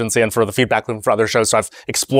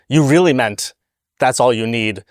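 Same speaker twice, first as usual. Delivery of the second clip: ordinary delivery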